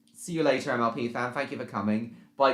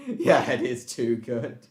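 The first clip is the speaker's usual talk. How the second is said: real laugh running into the words; amused laughing; slower than usual. Real, not acted. Streamer enjoying a joke in chat, laughing. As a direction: agree while still chuckling.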